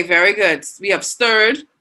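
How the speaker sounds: usual speaking voice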